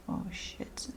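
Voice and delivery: ordinary talk, nothing marked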